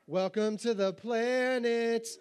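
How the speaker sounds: welcoming God. Pretend.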